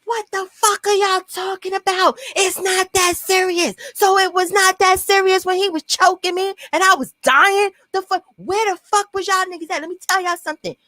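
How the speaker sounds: high-pitched, unhinged outrage; raging at friends who watched the choking; crazy people mode. Retold or imitated. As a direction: rant like you've lost it. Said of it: "I'm in crazy people mode".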